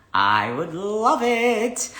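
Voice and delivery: slow, stretched sing-song I would love it; sing-song repeat. Real, not acted. Answering a viewer's comment with a slow sing-song. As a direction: draw the words out playfully.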